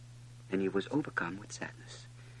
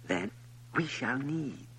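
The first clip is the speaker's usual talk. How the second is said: lightly voiced fox, higher pitched; earnest higher character voice, explaining with care; 7 semitones higher, 6 dB louder than usual. A narrator's reading. Fox explains taming to the little prince, earnest and insistent.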